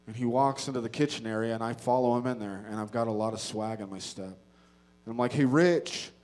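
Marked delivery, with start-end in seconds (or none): 5.3-6.1 loud, raised hail; confrontational call-out. Retold or imitated. Man confronting his arch enemy in the kitchen, bold call-out. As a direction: call him out across the kitchen.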